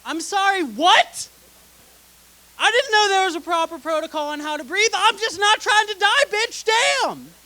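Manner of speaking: loud, high-pitched comic outrage; exasperated, rising-pitched mock rant; indignant comeback. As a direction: fire back as if absurdly accused. Acted comeback to wife's complaint.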